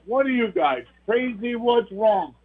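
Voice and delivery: high-pitched, incredulous questioning; baffled onlookers. Retold or imitated. Onlookers question the celebrating boys.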